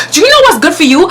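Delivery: retold indignant threat, pitched high; in-his-face challenge. Storyteller replays challenging the man in his face, angry. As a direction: challenge him as if squaring up. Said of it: "I'm up in his face".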